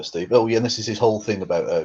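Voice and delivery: ordinary delivery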